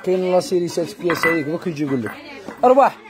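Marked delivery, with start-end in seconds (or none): 2.6-3.0 calling out. Real, not acted. Seller calls someone over loudly.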